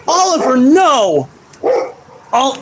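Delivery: sharp high yell of the dog's name. Real, not acted. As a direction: stop the dog mid-bark with a high shout. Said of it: "That is why I am yelling".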